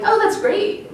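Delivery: high, light mock approval; approving inner voice. Part of her applauding the future nurses, approving inner voice. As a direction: lift the pitch into supportive cheer. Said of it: "a part of me is like".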